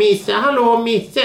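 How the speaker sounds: high sing-song call to a cat. Retold or imitated. Neighbour calling her cat.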